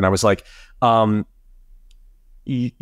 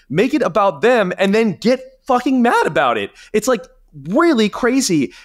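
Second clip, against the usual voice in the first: incredulous outrage; 9 semitones higher than usual. Real, not acted. Outraged at dogpiling critics.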